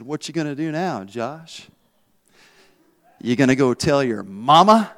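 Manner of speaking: low, soft acted-out taunt; quiet taunt. Cousin goading the beaten boy on the bus, quiet taunt. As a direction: needle him softly, pausing before the last jab.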